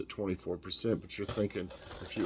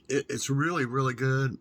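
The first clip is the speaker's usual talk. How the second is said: louder, higher, delighted praise; excited praise; 6 semitones higher, 7 dB louder than usual. Real, not acted. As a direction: really sell how good it is. Second, emphatic tasting verdict.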